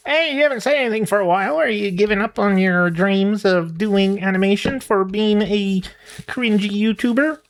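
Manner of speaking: the chicken taunts the aging YouTuber; mocking chicken voice. Voicing the chicken mocking him.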